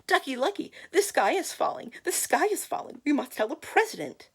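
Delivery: high, loud hen calling out urgently; frantic little hen; clucked alarm. Acted. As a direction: cluck the warning in a high, excited voice. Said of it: "and clucked".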